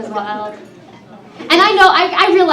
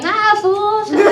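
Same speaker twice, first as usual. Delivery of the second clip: high-pitched East L.A. Chicano slang voice; East L.A. Mexican accent; 7 semitones higher than usual. Acted. Comic blending in with East L.A. Mexicans, East L.A. accent. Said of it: "East L A, I, I, I blend in".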